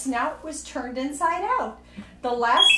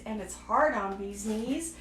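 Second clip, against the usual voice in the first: ordinary delivery, nothing marked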